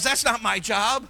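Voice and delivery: voiced excuse. Preacher voicing his flock's excuse, mock refusal. Mimicking congregation's excuse, then echoing it.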